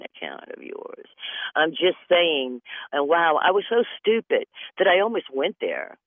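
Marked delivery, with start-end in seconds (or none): 0.0-1.1 whispered sly aside. Pretend. Slyly whispering the scammer's real motive. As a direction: hush it like a secret.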